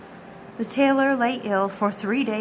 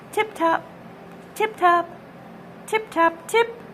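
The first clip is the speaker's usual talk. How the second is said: tapping noises; 7 semitones higher than usual. A narrator's reading. Chorus of little tappings.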